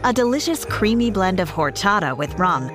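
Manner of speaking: ordinary talk, nothing marked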